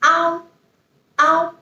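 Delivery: ow said high; final ao. Shown as a demonstration. Teacher introducing final ao. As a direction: give ao as a high ow. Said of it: "A O's pronunciation is".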